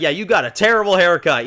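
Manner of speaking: high, loud mocking jab; mocking quote. Recapping Jadis to viewers, high loud mocking voice. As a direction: tease him about the haircut.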